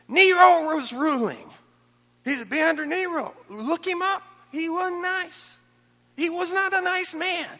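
high, loud, slow preaching cry; preaching shout. Real, not acted. Preacher to the congregation, shouting high and slow. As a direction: drive it home in a high ringing shout.

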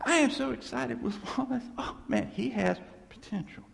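gushing world voice. Retold or imitated. The world praising a promising believer, mock excitement. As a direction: play the flattering world, overexcited. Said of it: "And then the world".

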